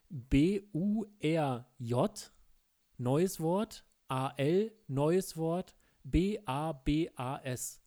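slow letter-by-letter spelling. Shown as a demonstration. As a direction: spell each letter slowly and clearly. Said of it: "Ich buchstabiere es". (langsam buchstabiert)

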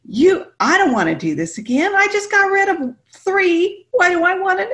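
loud, high-pitched mock protest; hammed-up exasperated complaint to God; arguing with God. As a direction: plead your case loudly, voice way up. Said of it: "I'm having this argument with God".

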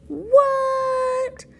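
squeaky high what; mock surprise. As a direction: pretend shock, squealed.

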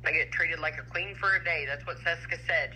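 usual speaking voice

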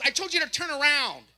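a fed-up officer repeating herself; scolding cop. Retold or imitated. Officer scolding the driver, high loud scold. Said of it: "She goes".

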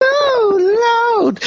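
high, wailing reaction; shocked exclamation. Real, not acted. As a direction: cry out high at the scandal. Listener reacts to shocking story.

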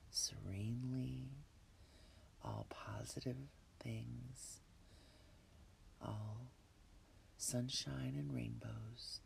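breathy whisper, calm and unhurried; guided meditation. Acted. Leads a relaxation meditation.

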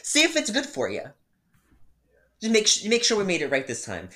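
very high, loud, saccharine sarcasm; sarcastic. Retold or imitated. Barista handing over the remade drink to the customer, sarcastically sweet. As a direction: serve it with fake sweetness.

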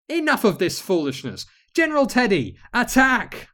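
high, loud battle order; commanding shout. Acted. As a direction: order your troops to attack. Queen orders attack.

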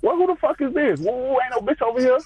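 a mock outraged mother, high and quick; imitating mama. Mama voiced in a retold story.

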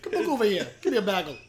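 silly Canadian impression; mock Canadian accent. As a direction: put on a broad Canadian lilt. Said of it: "Mine's Canadian".

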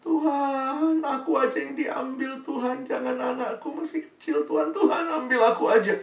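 high, wailing mock cry to God; a grieving mother at her son's coffin, high wailing plea; mother's wailing plea. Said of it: "mamanya itu teriak-teriak".

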